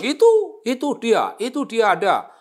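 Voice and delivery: high, loud pointing shout; excited calling out. Retold or imitated. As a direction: call out eagerly on spotting him. Guest calling out on seeing him.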